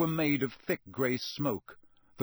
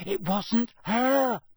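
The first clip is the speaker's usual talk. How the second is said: raised, very slow nervous interjection; 10 semitones higher, 5 dB louder, much slower than usual. A narrator's reading. Hermione defending the elf, nervous but determined. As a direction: speak up despite the nerves. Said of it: "She looked very nervous".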